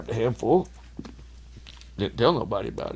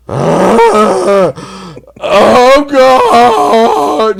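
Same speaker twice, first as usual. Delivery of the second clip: high, loud anguished howl; cry out in agony; 12 semitones higher, 13 dB louder, much slower than usual. Retold or imitated. Overheated drunk crying out in the tent, loud howl. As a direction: wail like you're burning up inside. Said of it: "you just hear".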